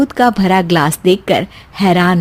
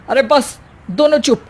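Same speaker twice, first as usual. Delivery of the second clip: ordinary talk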